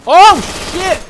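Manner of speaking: a sudden very loud, high shriek of shock; jolted, screaming out the curse; startled yell. Real, not acted. Gamer meeting an enemy face to face, shocked yell.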